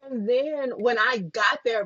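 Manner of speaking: normal talking voice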